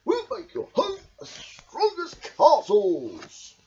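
high, slow, steady promise; confident Badger. A narrator's reading. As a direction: reassure them proudly. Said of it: "smiled Badger".

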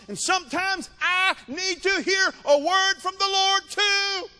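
high-pitched, impassioned pulpit proclamation; full-force preaching. Acted. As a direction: preach it high, driving each phrase home.

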